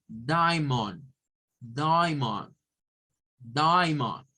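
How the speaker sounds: diamond as DYE-mond, repeated slowly; model pronunciation. Shown as a demonstration. Teacher repeating diamond for students to copy. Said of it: "va a sonar a die".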